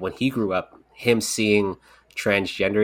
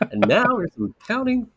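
mock radio host, pitched way up; 12 semitones higher than usual. Joking after showing off "my radio voice", mock radio announcer. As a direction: announce it in a playful high DJ voice. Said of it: "That's my radio voice".

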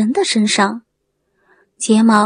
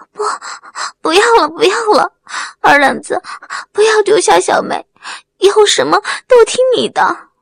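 high, tearful rush of begging; frantic pleading; 8 semitones higher, faster than usual. A narrator's reading. Wang Mei begs Erlengzi not to leave, frantic and tearful. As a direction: plead urgently through sobs, pitched up.

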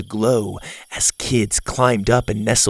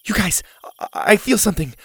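higher, halting with wonder; breathless wonder; 8 semitones higher, faster than usual. A narrator's reading. Girl tells friends her egg is moving, awed and halting. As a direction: stammer out the discovery. Zeta feels egg trembling.

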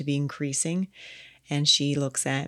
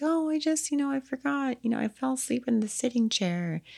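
much higher, lightly put-on breezy voice; airy wife excuse; 8 semitones higher than usual. Wife brushing off husband's question, high and breezy. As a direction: toss off the excuse in a high dreamy voice.

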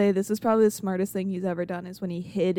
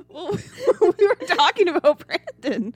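talking through laughter; laughing; 8 semitones higher, 4 dB louder than usual. Real, not acted. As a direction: giggle the words out. Laughing while teasing.

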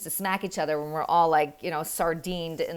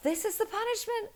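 kind but firm; 9 semitones higher than usual. Retold or imitated. Mother telling husband the punishment stands, kind and firm. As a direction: hold the line gently. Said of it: "And I said".

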